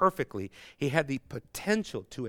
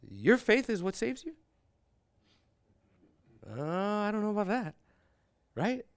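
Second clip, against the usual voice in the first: higher mocking voice of the debate opponent; imitating the opponent; 9 semitones higher than usual. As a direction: mimic his doubtful, pitched-up tone. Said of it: "So this guy talks like this nonstop".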